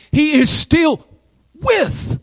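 high, ringing declaration on "with"; triumphant proclamation. Real, not acted. Triumphant preaching climax.

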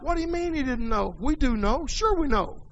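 incredulous retort. Real, not acted. Incredulous retort to quoted Balaam.

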